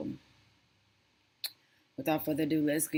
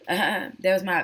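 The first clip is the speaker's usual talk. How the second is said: ordinary delivery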